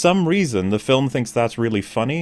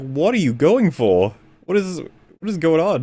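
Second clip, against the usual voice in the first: incredulous; 8 semitones higher, 3 dB louder than usual. Retold or imitated. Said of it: "I was just like".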